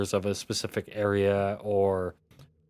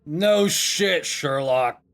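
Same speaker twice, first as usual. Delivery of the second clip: loud, higher-pitched exasperated retort; fed up, pitched up and loud; irritated sarcasm; 5 semitones higher, 4 dB louder, much slower than usual. Acted. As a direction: throw it back at the pest outside your door. Woken NPC snaps at visitor.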